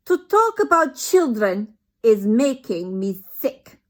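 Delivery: put-on loud, slow accented scream. Grand High Witch to the witches, loud slow accented scream. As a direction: scream in disgust. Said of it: "screamed the Grand High Witch".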